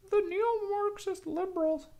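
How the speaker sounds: very high-pitched, badly faked impression; Jordan B. Peterson. Testing a professor impression with a raspy voice, high and strained. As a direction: strain for it far above your own voice. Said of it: "normally I can do a pretty good J B P impression".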